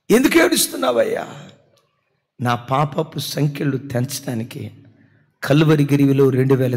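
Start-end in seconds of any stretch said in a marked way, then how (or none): 0.1-1.3 higher, fast pressing question; urgent question. Retold or imitated. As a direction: press him for why, raised and quick. Asking crying man, loud. (బిగ్గరగా వేగంగా అడగడం)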